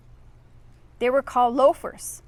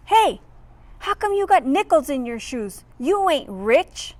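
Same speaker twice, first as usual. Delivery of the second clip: yelling; 4 semitones higher, 4 dB louder than usual. Acted. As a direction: shout it at him from behind.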